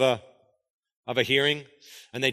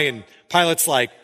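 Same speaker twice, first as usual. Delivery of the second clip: normal talking voice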